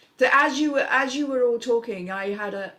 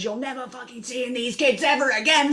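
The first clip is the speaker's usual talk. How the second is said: angry mother; 4 semitones higher, 3 dB louder than usual. Acted. Mother arguing on phone, acted.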